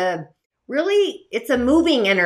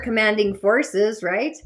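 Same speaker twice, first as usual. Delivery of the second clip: normal talking voice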